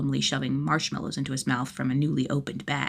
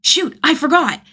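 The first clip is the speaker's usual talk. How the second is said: much higher, sudden realization; startled kid; 11 semitones higher, 9 dB louder than usual. Acted. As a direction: blurt it out, alarmed. Kid's exclamation.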